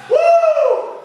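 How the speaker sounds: high, loud celebratory whoop; excited whoop. Real, not acted. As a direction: whoop to pump up the class. Coach whoops after countdown.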